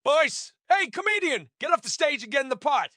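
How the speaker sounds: high-pitched heckler calling out; high, interrupting jeer; grating heckler. A narrator's reading. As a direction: heckle from the back of the room. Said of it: "grating voice interrupts".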